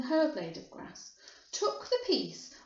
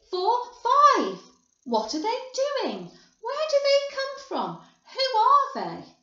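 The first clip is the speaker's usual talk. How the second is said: high, loud clamour of onlookers; amazed high-pitched calls; excited shouting; 10 semitones higher, 8 dB louder than usual. A narrator's reading. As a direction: shout each line like a new person in the crowd. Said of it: "everybody started shouting at once".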